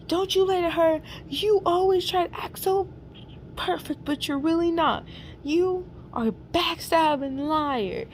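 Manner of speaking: high-pitched accusation, slowing on the last words; mock freaking out. Norman accusing Hannibal of lying, acted freak-out. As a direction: act out a high, worked-up telling-off. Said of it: "Norman was freaking, freaking out".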